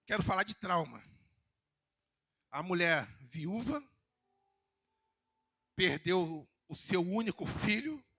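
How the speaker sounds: usual speaking voice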